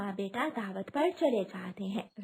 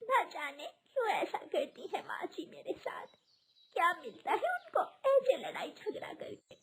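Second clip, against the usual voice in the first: tearful lament; 6 semitones higher than usual. Acted. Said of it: "नदी पर जाकर रोने लगती है".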